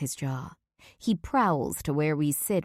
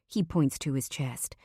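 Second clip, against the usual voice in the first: ordinary delivery, nothing marked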